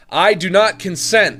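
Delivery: loud, high I do not consent; mock outraged protest; indignant shout. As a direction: yell the refusal.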